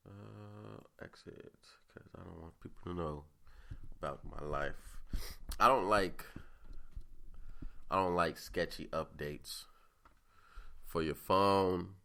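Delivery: ordinary talk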